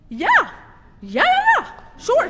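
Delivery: very high, loud yelped agreement; comic panicked squeal of consent; flustered over-eager yes. Retold or imitated. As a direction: blurt it out far too keenly. Flustered yes to child's request.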